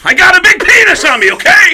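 high, loud comic yell; exasperated outburst. Retold or imitated. Drummer admitting it to watching bandmates, exasperated yelling. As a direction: blurt it at full volume.

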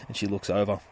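ordinary talk, nothing marked

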